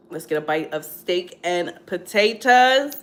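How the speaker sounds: ordinary talk, nothing marked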